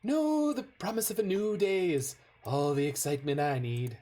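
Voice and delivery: a light take on a cartoon billionaire; Mr. Burns impression. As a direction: quote Burns like a wry, sinister old boss. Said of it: "I love Burns with the coffee".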